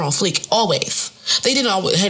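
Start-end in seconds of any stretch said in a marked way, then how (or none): none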